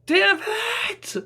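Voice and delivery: piercing curse at the sky; yell. Acted. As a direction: scream out the grief. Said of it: "I yelled".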